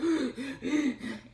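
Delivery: loud, high gasps for air between pushes; imitated gasping. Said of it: "in between pushes, I was like".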